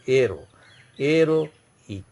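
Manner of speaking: "Aeroic" starting on E, with a break before "ic"; silent h. Shown as a demonstration. Teacher showing the mute H, "Aeroic" begun on the vowel. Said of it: "pronunciation starts from E".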